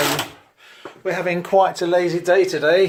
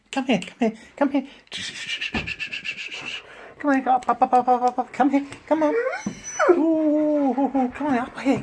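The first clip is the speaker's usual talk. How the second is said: high sing-song voice for the dog; pet talk; 8 semitones higher than usual. Acted. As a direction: coo at an excited puppy. Owner cooing to her dogs.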